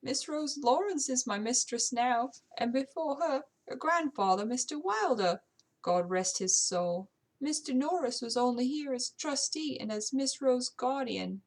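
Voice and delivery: amateur British servant voice; British housekeeper. Acted. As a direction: voice a servant speaking up about her household.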